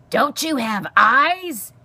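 loud, high, drawn-out screech. A narrator's reading. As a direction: screech it in outraged disbelief. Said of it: "Aerith screeched".

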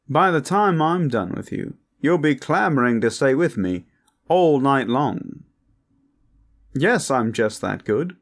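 high, loud, showy come-on; flirtatious braggart Hakan. Acted. Hakan brags to a reluctant guest, flirtatious and over the top.

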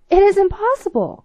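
the king bursts out in disbelief; crying out. Acted. King refuses to believe the groom is a servant, loud cry. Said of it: "cried the king".

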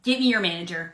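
ordinary delivery

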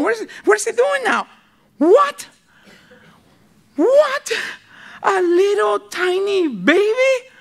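astonished angels. Retold or imitated. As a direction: gasp out the surprise, then marvel slowly. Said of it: "they are just going nuts".

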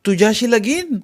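indignant, high-pitched question; flaring up. A narrator's reading. Girl outraged at playing bride, indignant. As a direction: throw it back, affronted. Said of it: "पण ती भडकलीच".

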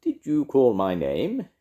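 loud, slow, swooping magician voice; drawn-out theatrical query; magician character. A narrator's reading. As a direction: announce yourself grandly with a high rising question. Magician figure enters the story.

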